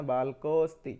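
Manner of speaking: बालकोस्ति run together, अ swallowed after को; near-silent अ. Shown as a demonstration. Teacher showing the pronunciation, अ almost silent in बालकोस्ति. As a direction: say बालकोस्ति with only a trace of अ. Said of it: "उच्चारण है, pronunciation है".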